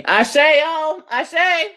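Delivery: high, loud happy cry; excited call. Real, not acted. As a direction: shout it out in delight at the angel number. Son reminds her of 11:11.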